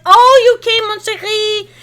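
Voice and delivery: high, loud, gushing cry of welcome; overjoyed greeting. Acted. Ruby runs to hug her sister, overjoyed squeal.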